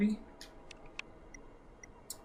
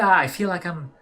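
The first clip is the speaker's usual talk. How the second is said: ordinary talk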